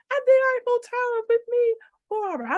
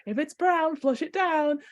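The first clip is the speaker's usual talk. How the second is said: ordinary talk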